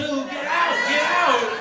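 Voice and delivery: high, loud frantic warning; panicked yell. Retold or imitated. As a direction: shout at your younger self to leave. Older self yelling warning.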